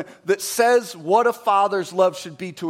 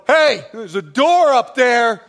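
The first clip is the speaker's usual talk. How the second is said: dismissive dad; 5 semitones higher, 7 dB louder than usual. Retold or imitated. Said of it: "Dad didn't sit on the bus and go".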